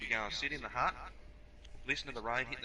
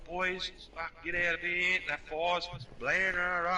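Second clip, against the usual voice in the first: mock fireman's warning, pitched up; urgent firefighter; 6 semitones higher than usual. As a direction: urgently wave the boys away. Acting the fireman warning the boys.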